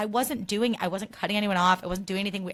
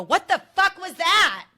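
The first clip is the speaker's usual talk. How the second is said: loud, higher mock shout from a passing car; retold yell; 10 semitones higher, 8 dB louder, slower than usual. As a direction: bark it like an angry driver. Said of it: "they go".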